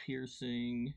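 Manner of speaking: ordinary talk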